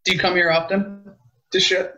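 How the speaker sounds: ordinary talk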